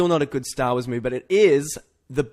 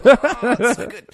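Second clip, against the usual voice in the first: louder and higher, laughing through it; laughing gush; 11 semitones higher, 7 dB louder, slower than usual. Real, not acted. Host laughing as he gushes about Star Wars. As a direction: burst out laughing in delight.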